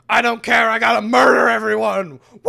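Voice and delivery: high, loud bloodthirsty yell; hammed-up murderous orc cheer; mock orc shout. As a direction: bellow it like a gleeful savage orc. Orc voiced in game retelling.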